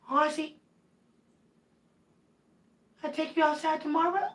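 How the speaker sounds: soft high coo; hushed sweet pet voice. Real, not acted. Talking to her cat.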